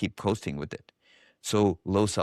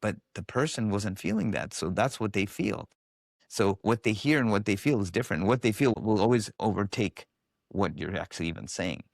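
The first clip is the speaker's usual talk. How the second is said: normal talking voice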